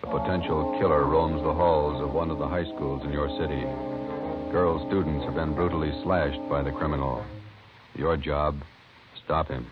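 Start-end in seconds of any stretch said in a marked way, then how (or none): none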